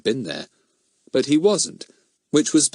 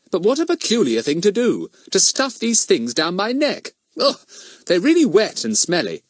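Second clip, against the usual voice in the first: high-pitched Fatty figure, complaining; higher boy's voice, put out; indignant schoolboy; 8 semitones higher, 3 dB louder than usual. Acted. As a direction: protest with boyish disgust.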